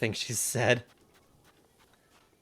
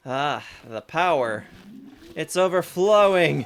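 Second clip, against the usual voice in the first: rising excitement; 6 semitones higher, 7 dB louder than usual. Acted. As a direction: swell up as the power overflows. Fairy powering up, read aloud.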